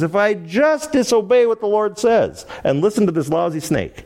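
hammed-up talking yourself into it; rationalizing. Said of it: "Now she's rationalizing".

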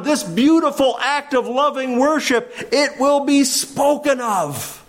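high, driving sermon voice; fervent pulpit preaching. Acted. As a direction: build the call with fervour. Preaching at full force.

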